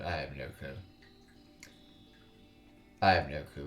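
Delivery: ordinary talk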